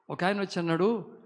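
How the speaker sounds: ordinary delivery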